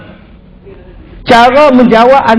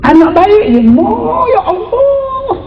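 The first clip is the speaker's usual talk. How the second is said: mother lament; 12 semitones higher, slower than usual. Retold or imitated.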